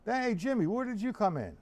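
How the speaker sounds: high-pitched hail to a newcomer; calling out greeting. Real, not acted. Teacher greeting student who just came in, calling out. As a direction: pitch it up, call over to them.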